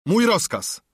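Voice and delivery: high-pitched, brief captain's retort; curt. A narrator's reading. Captain answering the cook's complaint, curtly. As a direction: cut the objection short with authority. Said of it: "rzekł krótko kapitan".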